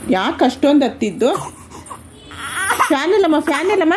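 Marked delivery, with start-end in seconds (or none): 2.9-3.9 higher, sing-song and playful. Real, not acted. Mother telling her baby there's no fan, in playful baby talk.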